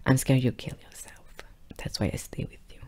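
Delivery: soft unvoiced whisper; whispered confession. Girlfriend explaining why she stays, hushed whisper. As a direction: hush it like a painful secret.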